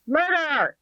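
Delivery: high mock yell of the word. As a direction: call it out like a protester's cry.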